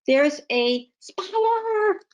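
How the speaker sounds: high, slow, stretched-out alarm at a spider; hammed-up spider fright; scared exclamation. Shown as a demonstration. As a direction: act out being startled by a spider. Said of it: "this would be me, by the way".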